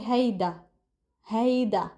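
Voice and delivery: "هيدا" as "HAY-da", with a hard d; Lebanese pronunciation. Shown as a demonstration. Teacher showing her country's word, Lebanese pronunciation. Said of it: "we omit the da sound".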